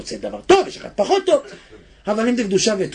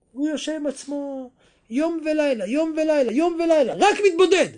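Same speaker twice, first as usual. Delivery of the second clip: building preaching; 8 semitones higher than usual. Acted. Preacher stresses Moses' solitude to listeners, building. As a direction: climb with each repetition. Repeats day and night, rising.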